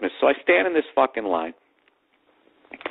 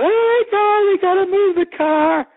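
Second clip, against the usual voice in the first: high, drawn-out mock panic; imitated freaking out; 4 dB louder, slower than usual. Girlfriend spots a parking ticket, hammed-up freak-out. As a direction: shriek it slowly like a panicking girlfriend. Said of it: "So she starts freaking out".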